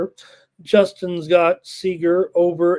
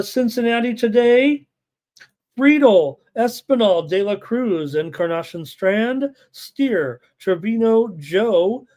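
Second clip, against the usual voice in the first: higher-pitched roll call of names; lineup announcement; 4 semitones higher, slower than usual. Real, not acted. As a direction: read out the lineup name by name, winding down slowly on the last. Reads out team lineups.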